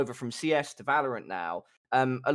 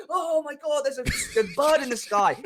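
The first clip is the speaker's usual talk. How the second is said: high-pitched, hammed-up alarm; mock panic; 11 semitones higher than usual. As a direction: mimic players panicking at a drone. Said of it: "because everyone starts panicking. They're like".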